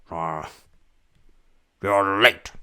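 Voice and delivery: a hint of a cranky old man snapping; lightly voiced old man complaining; grumpy old man. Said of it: "He's probably in his wheelchair like".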